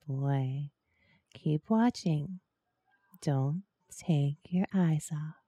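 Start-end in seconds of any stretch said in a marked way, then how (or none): 3.2-5.3 whispering. Acted. Hypnotic instruction whispered.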